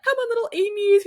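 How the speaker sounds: rounding up the little ones, teacher style; teacher mode. Retold or imitated. Mum herding a group of Nippers kids to the water, high teacher call. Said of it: "in teacher mode".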